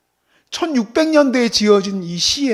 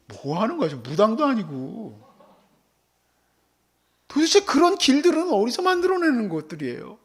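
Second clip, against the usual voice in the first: ordinary talk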